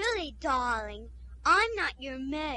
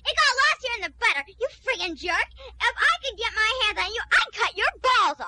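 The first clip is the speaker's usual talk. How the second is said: screaming threats, much higher and louder; raging threat; 7 semitones higher, 8 dB louder than usual. Acted. The hostess rages at the guest, screaming threats. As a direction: let the fury rip.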